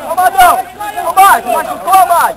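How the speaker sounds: real loud shout to a teammate; calling out. Real, not acted. Calls out to a player on pitch.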